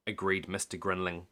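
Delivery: ordinary delivery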